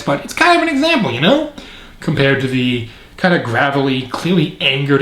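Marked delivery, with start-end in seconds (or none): 0.2-1.5 much higher and quicker, squeaky take on the new Grinch; jokey impression of a too-high Grinch; mock high Grinch voice. Shown as a demonstration. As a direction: mimic the high Grinch voice, fast and pinched. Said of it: "I don't know why I'm talking like this".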